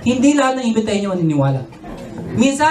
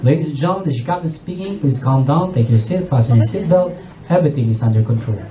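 much lower, steady pilot announcement; composed captain; 9 semitones lower than usual. Retold or imitated. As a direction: keep the cabin calm in turbulence. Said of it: "And compose, very positive".

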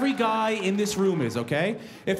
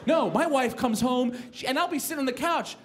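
ordinary talk, nothing marked

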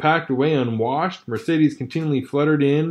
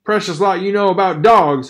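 louder, higher sneering jab; mock his sister; 5 semitones higher, 5 dB louder than usual. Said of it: "her brother sneered".

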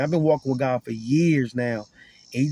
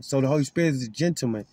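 usual speaking voice

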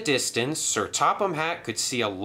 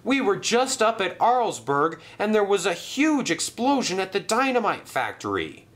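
calling out news; 10 semitones higher than usual. Acted. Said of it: "they shouted".

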